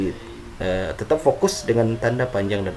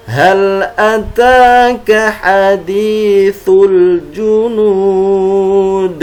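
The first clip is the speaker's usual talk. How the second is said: slow, careful Quran recitation; over enunciating; 8 semitones higher, 9 dB louder, much slower than usual. Shown as a demonstration. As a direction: chant each syllable deliberately. Recites verses, each twice.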